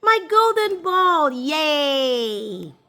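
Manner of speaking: high happy cry over the ball; delighted princess. A narrator's reading. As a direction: squeal with joy at getting it back. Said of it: "said the princess".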